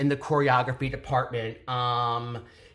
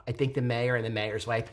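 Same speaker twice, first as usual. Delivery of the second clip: ordinary talk, nothing marked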